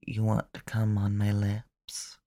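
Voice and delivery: ordinary talk, nothing marked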